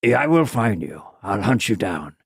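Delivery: low, measured movie-avenger impression; matter-of-fact threat. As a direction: imitate an action hero calmly threatening, pitch sinking. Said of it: "It's so matter of fact".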